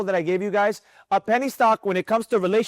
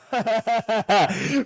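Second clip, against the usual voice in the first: hearty laugh at own joke. Real, not acted. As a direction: burst out laughing after joking.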